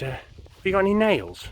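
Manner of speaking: high-pitched innocent question; innocent rabbit. Acted. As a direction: ask sweetly as the little rabbit.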